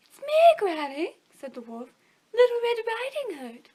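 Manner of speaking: put-on high, loud girlish voice; fake little girl. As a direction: pretend to be the girl. Wolf posing as the girl.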